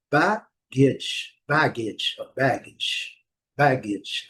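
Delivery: "baggage" with BAG strong, -gage weak; careful repeated "baggage", stress up front; first syllable stressed. Shown as a demonstration. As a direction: hit BAG in "baggage" and let it fall to -idge. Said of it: "A primeira sílaba".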